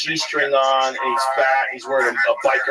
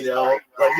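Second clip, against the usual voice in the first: ordinary delivery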